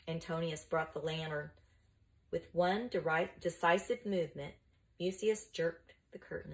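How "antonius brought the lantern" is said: plain reading voice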